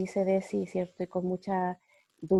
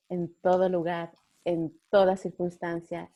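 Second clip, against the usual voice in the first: usual speaking voice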